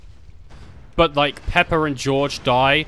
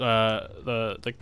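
ordinary talk

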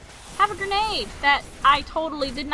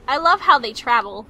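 usual speaking voice

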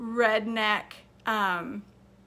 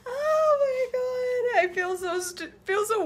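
crying with laughter; 13 semitones higher, 4 dB louder than usual. Real, not acted. Cracking up at time zones.

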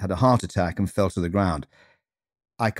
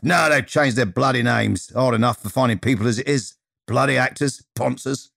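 higher, grumbling Cockney voice; Bosun, broad Cockney accent; 4 semitones higher than usual. Acted. Greeter complaining about renamed students, broad Cockney accent. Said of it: "with a broad Cockney accent".

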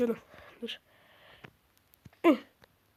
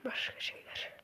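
whisper; 13 dB quieter, slower than usual. Real, not acted. Muttered aside off mic.

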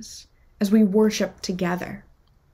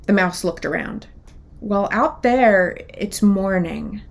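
lively picture-book read-aloud, characters voiced; children's story reading; 5 dB louder than usual. A narrator's reading. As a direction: tell it like storytime for kids.

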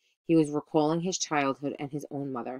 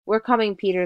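loud, higher-pitched rallying call; eager shout, pitched up; crying out; 6 semitones higher, 5 dB louder than usual. A narrator's reading. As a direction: shout back to a friend in trouble. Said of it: "they cried".

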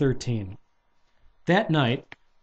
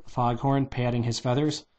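plain reading voice

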